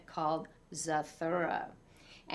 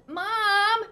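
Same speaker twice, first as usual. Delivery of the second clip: even higher, louder yell; desperate child's call; yelling for mom; 13 semitones higher, 8 dB louder than usual. A narrator's reading. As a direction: bellow it across the house. Child calling for mother again.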